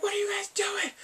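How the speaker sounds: high-pitched mock outrage; pissed. Viewer acts the doctor catching them in the lab, pissed. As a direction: squeal it in indignant disbelief. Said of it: "and he is pissed".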